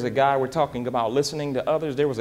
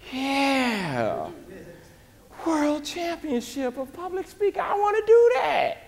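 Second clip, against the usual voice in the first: much higher and climbing, thrilled; giddy excitement; 12 semitones higher, slower than usual. Retold or imitated. Speaker reliving his giddy reaction to the championship.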